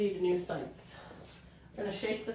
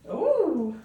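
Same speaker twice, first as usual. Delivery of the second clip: teasing puppy talk; 9 semitones higher, 4 dB louder, much slower than usual. Real, not acted.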